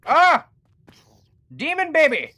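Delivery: startled shriek. Real, not acted. Startled by monster in game.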